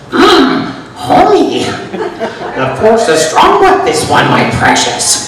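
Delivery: high croaky cartoon hum sliding into slow creature rasp; Marge Simpson, Yoda and Gollum. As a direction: mash three cartoonish creature voices together, slowly. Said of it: "cross between".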